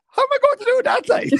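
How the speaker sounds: high, loud, fast protest; comic disbelief. Real, not acted. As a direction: squeal it out, exasperated and amused. Comic protest at rhyming in Chinese.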